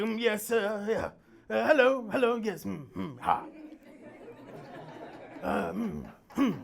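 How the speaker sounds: stammering mumbles with false starts; Boris Johnson. Retold or imitated. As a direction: sputter like a car trying to start. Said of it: "you sound like a car trying to start".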